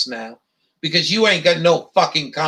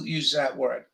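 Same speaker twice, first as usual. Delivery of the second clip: ordinary delivery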